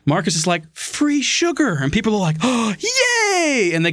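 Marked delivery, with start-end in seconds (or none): 0.7-1.8 high, drawn-out mock hawking; luring sales call. Acting out the seller luring people. 2.4-3.7 high, hammed-up gleeful yay; delighted cheer. Acting out delighted customers.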